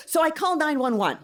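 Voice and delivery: ordinary delivery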